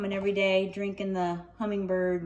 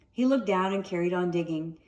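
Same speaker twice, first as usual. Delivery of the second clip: usual speaking voice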